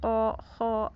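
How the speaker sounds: "Ko, ho" with lips not pushed forward; "Ko, ho" as round, unpouted letter sounds. Shown as a demonstration. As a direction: say "Ko, ho" slowly, rounded without a pout. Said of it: "itu nggak monyong".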